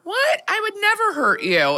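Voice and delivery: high-pitched, emphatic mock indignation; shocked denial. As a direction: act appalled at the very suggestion. Models a shocked denial of harm.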